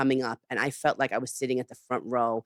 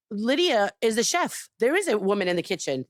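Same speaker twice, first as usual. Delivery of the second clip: indignant retort; 7 semitones higher than usual. Retold or imitated. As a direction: push back emphatically, recounting the argument. Retold argument with the chef.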